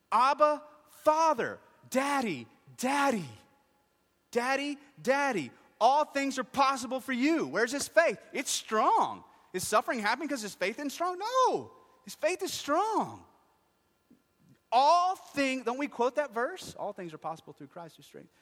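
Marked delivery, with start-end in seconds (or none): 0.1-5.5 high, shouted plea to a father; crying out. Acted. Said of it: "He cried out". 5.8-7.5 high, full-voiced shouted prayer; crying out. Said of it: "He cried out". 7.5-16.7 high, shouted sermon delivery; full-force preaching. Real, not acted.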